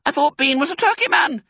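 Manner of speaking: very high, loud cub voice; puzzled little fox. A narrator's reading. Smallest fox to his dad, high and puzzled. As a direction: query it in a piping young voice.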